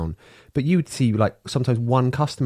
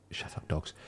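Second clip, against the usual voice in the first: quick whispered hush at the dogs; breathy aside to the pets; 15 dB quieter than usual. Real, not acted. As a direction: hiss it under your breath to the dogs. Aside hushing the barking dogs.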